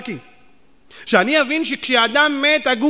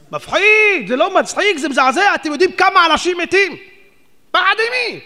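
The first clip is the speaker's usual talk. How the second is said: loud, much higher horrified exclamations; shocked cry to the congregation; 8 semitones higher, 5 dB louder than usual. Real, not acted. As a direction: preach the horror of it.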